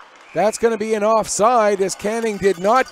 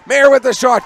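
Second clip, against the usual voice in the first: excited call; 4 semitones higher, 8 dB louder than usual. Real, not acted. Calling a shot on goal.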